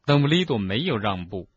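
usual speaking voice

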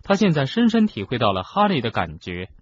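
plain reading voice

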